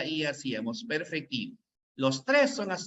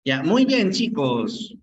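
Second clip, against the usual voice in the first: louder call to get the room back; calling the class; 2 semitones higher, 7 dB louder, slower than usual. Real, not acted. Teacher calling her pupils back to the lesson, loud. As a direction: raise the voice to pull attention back.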